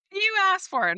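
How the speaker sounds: high, loud teasing comeback from a customer; cheeky customer quip. Retold or imitated. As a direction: toss it back at her playfully. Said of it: "And he said".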